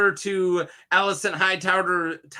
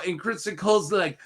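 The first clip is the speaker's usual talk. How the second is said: normal talking voice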